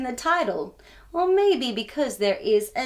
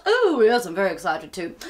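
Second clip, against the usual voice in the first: deep puppet voice; 7 semitones lower, faster than usual. Acted. Sir Lovelot puppet answers.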